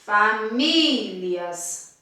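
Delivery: famílias very slow, MÍ held long; demonstrative famílias, middle syllable stretched; stress on MÍ in famílias. Shown as a demonstration. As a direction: drag out the MÍ of famílias. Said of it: "Eu puxo mais pra qual sílaba?".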